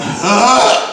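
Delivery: high, loud startled huh; sharp surprised grunt. Retold or imitated. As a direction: gut recoils loudly. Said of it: "my body is going".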